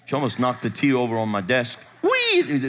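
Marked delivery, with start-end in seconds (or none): 2.0-2.7 one very high mock squeal; fake drunken whooping. Pastor mimics wife nearly knocking over tea, high fake whoop. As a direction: toss out her put-on whee. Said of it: "Doing her wee thing".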